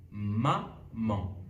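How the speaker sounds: maman as ma, short pause, man; split into syllables. Shown as a demonstration. Teacher dictates a word to invert, "maman" split in two. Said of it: "faire la même chose avec le mot".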